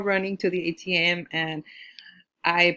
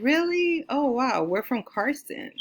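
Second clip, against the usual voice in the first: surprised; 7 semitones higher than usual. Retold or imitated. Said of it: "And they said".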